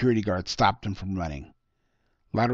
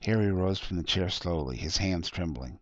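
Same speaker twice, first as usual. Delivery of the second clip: ordinary delivery, nothing marked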